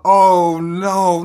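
normal talking voice

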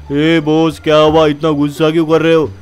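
loose, slurry thug voice with a swagger; tipsy goon. Acted. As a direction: play the drunk henchman lazing about. Undertaker answers the boss. (नशे में धुत गुंडे की आवाज़)

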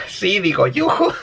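rising into a high mock "yuju"; imitación burlona. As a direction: ham up a girly self-correction. Mocks the Spanish subtitle. (burlándose, subiendo al agudo)

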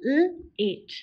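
sounded out slowly, onset split from the vowel; segmented. Shown as a demonstration. Teacher sounding out L-ate for the class. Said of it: "Repeat after me".

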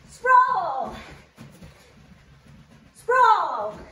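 barking gym coach; P E teacher voice. Real, not acted. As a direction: bark each rep loud and high. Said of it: "your P E teacher voice".

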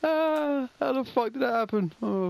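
usual speaking voice

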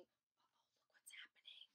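hushed, voiceless alarm; conspiratorial whisper; whispered aside. Real, not acted. Reader aside at the mayhem.

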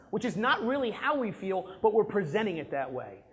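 ordinary talk, nothing marked

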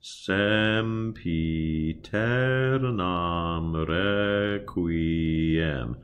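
"Sempiternam requiem" slow with open E, as in "bed"; slow choir demo; open E vowels. Shown as a demonstration. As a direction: spell it out slowly, syllable by syllable.

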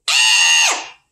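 high loud pretend shriek; play-scared squeal; mock scream. Teacher shrieks for the children, playful. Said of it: "don't forget to scream".

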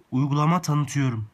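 projecting clearly into the phone; loud into the mic. Real, not acted. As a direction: speak up for the microphone. Testing the app microphone.

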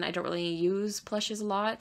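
ordinary talk, nothing marked

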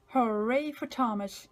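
plain reading voice

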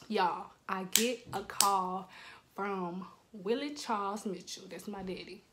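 ordinary talk

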